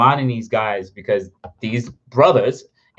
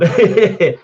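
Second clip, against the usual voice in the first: high, loud chuckle; laughing; 7 semitones higher, 7 dB louder than usual. Real, not acted. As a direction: break into a quick laugh. Laughs while chatting with viewers.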